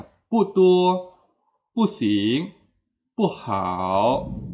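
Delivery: "pu tuo, pusing, pu hao", each "pu" short and falling; fourth-tone pu examples. Shown as a demonstration. Teacher reciting examples, "pu" falling in each pair. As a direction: drop "pu" before each following tone. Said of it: "contohnya ya".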